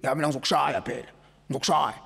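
fast, cocky threat in a lightly put-on voice; lightly voiced show-off promising to hit you; mock gym bully. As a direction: toss off the threat like a swaggering gym bro. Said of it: "sebefuna ukusihlupha".

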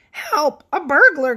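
mild storybook alarm; startled shout. Acted. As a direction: yell it as if woken by a burglar. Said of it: "Godfrey shouted".

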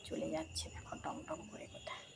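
very soft, voiceless whisper; whispered aside. Real, not acted. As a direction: whisper it to the viewers as the dog leaves. Aside as the dog walks off. (ফিসফিস করে বলা)

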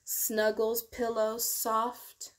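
ordinary delivery, nothing marked

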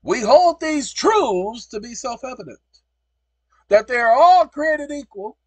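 loud, slow, high oratory; reciting the Declaration at full force; proclaiming the Declaration. Real, not acted. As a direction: declaim each word like a founding father.

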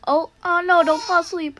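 begging yourself to stay awake, real alarm; panicked plea. Real, not acted. Character dozing off in horror game.

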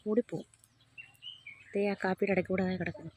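soft, hushed voice so the goats stay. Real, not acted. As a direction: keep it down, almost whispering. Keeping quiet near wild goats.

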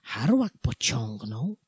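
plain reading voice

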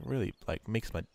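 normal talking voice

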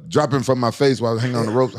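normal talking voice

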